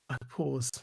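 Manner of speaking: fading to silence. Acted. Poem fading to its close.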